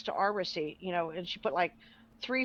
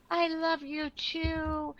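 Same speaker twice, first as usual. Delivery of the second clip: sweet reply; 6 semitones higher, much slower than usual. Retold or imitated. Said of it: "she goes".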